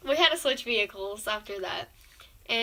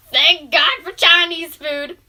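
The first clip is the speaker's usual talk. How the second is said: loud, slow, happy cry; a loud slow thank-god cheer; relieved cheer; 4 semitones higher, 14 dB louder, slower than usual. Retold or imitated. Finds food at the mall.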